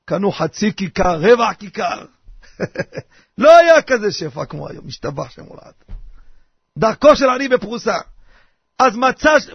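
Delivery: normal talking voice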